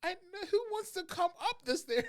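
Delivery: high loud outraged question; very high loud incredulous cry; incredulous shout. Retold or imitated. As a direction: blurt it out in disbelief. Incredulous retort.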